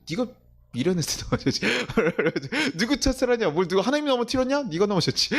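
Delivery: high, laughing scolding of a fool; laughing rebuke. Acted. Inner voice mocking the fallen pastor, high and laughing. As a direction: tease yourself for tripping, half laughing.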